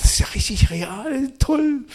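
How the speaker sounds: high, loud, thrilled exclamation; amazed joy. Retold or imitated. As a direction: burst out in delighted amazement. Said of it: "ich hab gesagt". (hoch, laut und begeistert)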